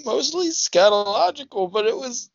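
high, fast hammed-up heartbroken voice; mock heartbreak. Heartbroken host explains the music reminds him of her, hammed-up sad. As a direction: whine about the lost girlfriend.

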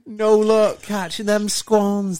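slow, loud mock announcer; mock advert voice. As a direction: ham up the advert line. Mimicking the advert voice.

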